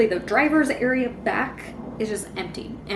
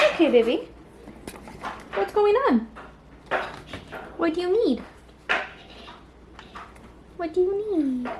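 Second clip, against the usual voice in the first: higher sing-song talk to the cat; pet talk; 8 semitones higher than usual. Real, not acted. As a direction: ask your cat gently in a high voice. Talking to the cat.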